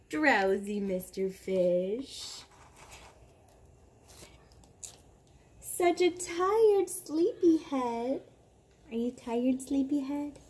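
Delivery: put-on slow, lilting read-aloud for a toddler; bedtime story reading. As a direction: read it like lulling a child to sleep. Reads bedtime book aloud.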